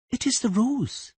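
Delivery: put-on high, calm young girl voice; a child speaking calmly. A narrator's reading. Siri calmly explaining the rose to Tris. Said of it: "the girl said calmly".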